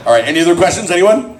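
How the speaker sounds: normal talking voice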